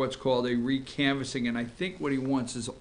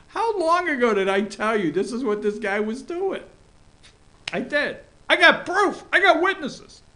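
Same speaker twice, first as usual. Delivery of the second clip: much higher, louder I-told-you-so; triumphant boast; 11 semitones higher, 5 dB louder than usual. Real, not acted. Host crowing to viewers about his prediction, much higher. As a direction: crow about being right.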